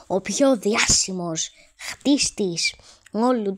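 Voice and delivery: loud, slow mock proclamation; admiring villagers. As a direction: proclaim it grandly, stretching the words. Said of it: "και να λένε". (θαυμαστική αναγγελία, αργά και δυνατά)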